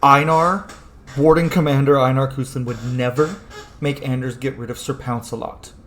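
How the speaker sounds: ordinary talk, nothing marked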